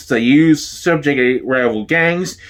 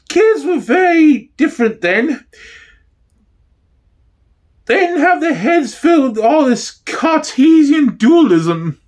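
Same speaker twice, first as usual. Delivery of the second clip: high falsetto gossiping housewife; man playing a chatty old neighbour, pitched up high; East End housewife; 11 semitones higher than usual. Neighbour reminisces to the interviewer in a high falsetto housewife voice.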